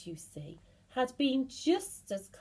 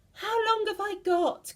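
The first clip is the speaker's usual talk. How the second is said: much higher, loud pleading cry; panicked cry; 12 semitones higher, 5 dB louder than usual. Acted. Mrs Twit panicking, begging her husband how long. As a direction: beg to know, panicking. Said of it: "cried Missus Twit".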